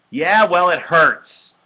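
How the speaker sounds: high, very loud retort; angry yell. A narrator's reading. Boy with sore nose answering sister, angry yell. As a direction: yell it back in pain. Hurt brother yells at sister.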